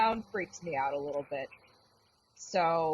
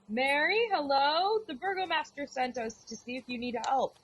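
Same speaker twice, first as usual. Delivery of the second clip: higher call up the hallway; 7 semitones higher than usual. Acted. Beatrix calling to Mary from the hall. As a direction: call through to a stranger's room. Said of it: "I'm gonna say again".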